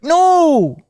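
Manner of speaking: high, loud shouted refusal. Retold or imitated. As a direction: yell it like clutching a treasure. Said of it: "And you're like".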